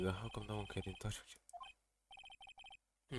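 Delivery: ordinary delivery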